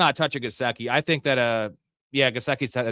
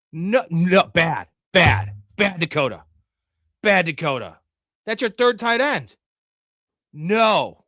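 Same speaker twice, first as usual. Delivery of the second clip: loud mock scolding, like to a naughty puppy; scolding a dog; 6 semitones higher, 5 dB louder, slower than usual. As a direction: wag a finger at him, half joking.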